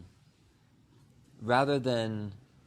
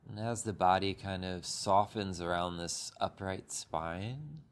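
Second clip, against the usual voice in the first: unhurried meditation guide with long pauses; calm, even voice, leaving space between phrases; guided meditation. Acted. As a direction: ease listeners gently through breath and body.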